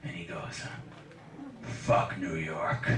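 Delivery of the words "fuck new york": low, slow mock bark; homeless bark. Homeless man barks at comic about the rain, low and slow. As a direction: growl it out like a street guy. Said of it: "homeless people kinda bark at ya".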